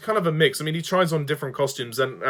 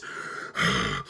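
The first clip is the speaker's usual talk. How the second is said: heavy monster breath; 6 dB quieter than usual. Retold or imitated. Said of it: "They constantly breathe".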